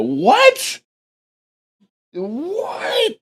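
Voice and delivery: high-pitched disbelieving what; mock shocked what; incredulous. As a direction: squeak it out in shock. Disbelief at the reply.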